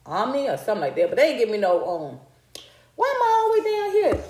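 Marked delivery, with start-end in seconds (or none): 3.0-4.2 high, quick startled outburst. Real, not acted. Vlogger surprised as her chair sinks, high startled cry. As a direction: caught off guard as the seat drops.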